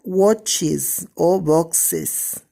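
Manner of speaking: watches and boxes spelled out slowly, each ending clear; teacher modelling plural endings slowly; slow demo of plurals. Shown as a demonstration. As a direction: stretch out watches and boxes for the class. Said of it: "en C H o en X".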